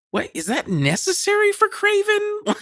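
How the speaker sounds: mock baffled outrage at the screen; high-pitched what-the-hell disbelief; incredulous. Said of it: "I was just like".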